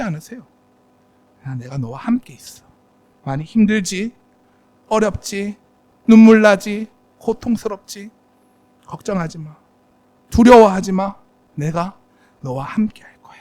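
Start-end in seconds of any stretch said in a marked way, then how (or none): 1.5-13.4 tender comfort. Pretend. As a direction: soothe him gently, fade to a whisper. God comforts Paul tenderly.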